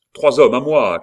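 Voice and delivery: loud, high rallying shout; forceful call for reinforcements; shouted order. A narrator's reading. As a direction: shout for your men. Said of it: "cria Montsoreau".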